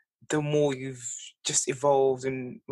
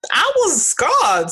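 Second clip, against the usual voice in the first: dramatic; 12 semitones higher, 7 dB louder, much slower than usual. Real, not acted. Dramatic complaint, high loud slow.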